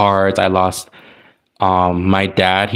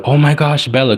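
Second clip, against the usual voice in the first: panicked gasp; 7 semitones higher than usual. Retold or imitated. Re-living the fire, calling for her old dog in panic. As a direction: blurt her name in fright, higher. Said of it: "I was like".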